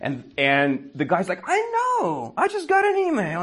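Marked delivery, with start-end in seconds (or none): 1.5-3.4 caller voiced much higher; comic high-pitched office guy; high squeaky caller. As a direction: squeak it like a fussy coworker.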